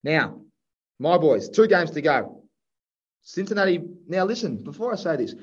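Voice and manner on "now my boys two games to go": ordinary talk, nothing marked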